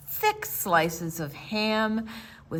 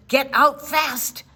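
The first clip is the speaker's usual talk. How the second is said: urgent call; 4 semitones higher, 6 dB louder than usual. Acted. Smoke alarm's message.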